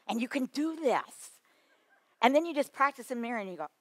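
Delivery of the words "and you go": normal talking voice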